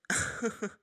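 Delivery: short breathy scoffing laugh under the breath; a quick, scornful little huff of a laugh. Acted. Mocking the disguised official, short breathy scoff.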